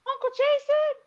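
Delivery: put-on high-pitched little kid calling out; tiny, very high child voice; little girl's voice. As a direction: mimic a small child calling. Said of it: "I hear this little voice".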